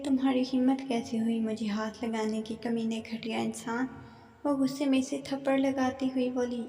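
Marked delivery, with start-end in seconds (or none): none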